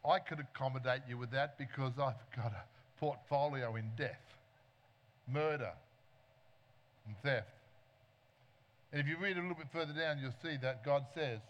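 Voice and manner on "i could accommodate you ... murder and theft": lower, sly devil offering a deal; devil's voice. Acted. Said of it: "And the devil said".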